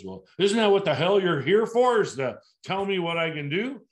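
loud, high mock outburst; angry customer. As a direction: play the fed-up client snapping back. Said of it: "the customer finally gets angry and says".

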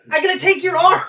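half-hammed threat through a laugh; mild villain quote. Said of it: "He's like".

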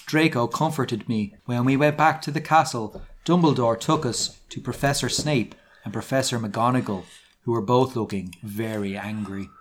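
ordinary delivery

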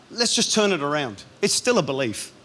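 usual speaking voice

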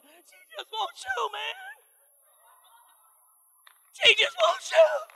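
very high, slow, pleading cry. Retold or imitated. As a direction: beg him to see it, stretched out high. Preacher pleads with husband.

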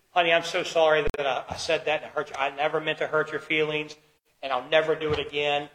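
soft, low, contrite apology; hushed and earnest, quickly owning up; sincere apology. Acted. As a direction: apologise tenderly to a wife, voice dropped. Models a repentant husband's apology.

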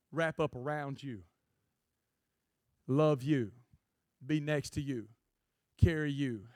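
ordinary delivery

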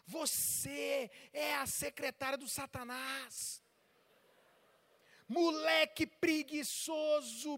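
slow, heavily drawn-out insults; acted anger. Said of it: "O que que as pessoas fazem quando tão com raiva?".

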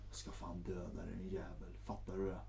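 hissed death threat, low and soft; threatening whisper. Retold or imitated. Girlfriend threatening him by phone, whispered. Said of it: "Då säger hon i telefon".

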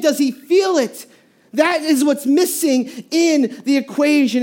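usual speaking voice

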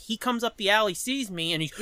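ordinary talk, nothing marked

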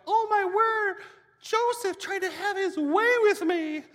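high, loud mock scream; fake outrage. Potiphar's wife accuses Joseph to the servants, high loud shriek. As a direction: shriek it to the servants like a wronged woman. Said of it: "So she screams".

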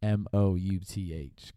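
slow, letter by letter, spelling bee. Shown as a demonstration. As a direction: spell each letter out slowly. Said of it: "One word".

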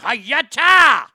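explosive mock karate cry, high and very loud; big, high-pitched fighting shout; karate yell. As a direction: yell it like a martial-arts strike. Acting out walking up to hit him.

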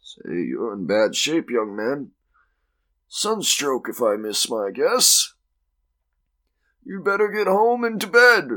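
put-on voice swooping up high; slowish, swooping character voice giving advice; bossy clubman. Harford to the young man with sunstroke, patronising.

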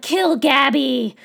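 loud, drawn-out yell; screaming at the screen. Retold or imitated. Viewer screaming at Sasha to kill Gabby, yelling. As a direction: yell it like an impatient fan. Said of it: "screaming at Sasha".

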